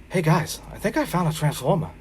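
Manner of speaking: breezy, slightly dopey Wahlberg voice; [name] impression. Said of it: "my old reliable [name] impression".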